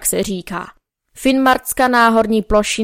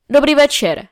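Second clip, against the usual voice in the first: called out greeting; 5 semitones higher than usual. A narrator's reading. Matis calls out good evening to the newcomer, a little raised. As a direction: call the greeting to the stranger on the road.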